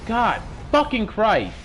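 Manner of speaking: high, loud and slow, hitting fucking; furious curse. Real, not acted. Swearing after the game goes wrong, slow furious shout. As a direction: drag each word out in rage.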